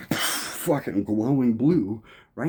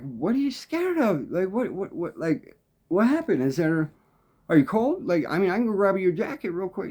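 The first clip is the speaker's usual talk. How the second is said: concerned questions; 9 semitones higher than usual. Retold or imitated. He asks the frightened stranger.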